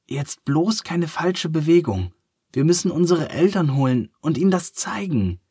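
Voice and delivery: put-on high, excited little children's voices; breathless kids trying not to topple the tower; elephant children. A narrator's reading.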